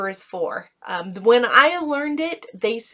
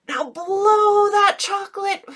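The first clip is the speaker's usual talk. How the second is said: put-on higher, bright call to the kids; playful kid-directed cue; 11 semitones higher, 4 dB louder than usual. Shown as a demonstration. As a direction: sing it out to a child. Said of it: "breathe into your belly".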